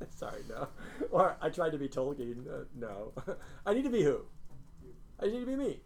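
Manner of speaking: usual speaking voice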